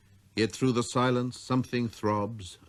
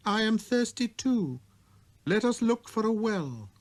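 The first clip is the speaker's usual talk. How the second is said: put-on high, light boyish voice; a small boy's clear greeting; little prince voice; 12 semitones higher than usual.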